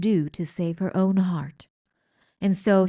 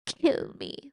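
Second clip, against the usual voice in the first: despairing plea; 7 semitones higher, 3 dB quieter than usual. Acted. Despairing rat.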